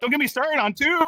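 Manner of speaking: high-pitched comic indignation; mock outraged rant. Comic dismissing the used-up cartoon sponge, mock outraged.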